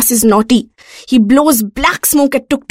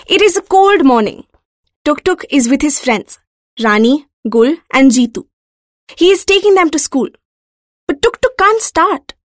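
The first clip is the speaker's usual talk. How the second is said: plain reading voice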